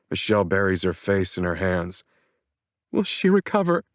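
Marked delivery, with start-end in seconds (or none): 2.9-3.8 put-on high, fragile young woman's voice; worried daughter. A narrator's reading. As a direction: ask it barely holding on. Daughter asks about dying mother.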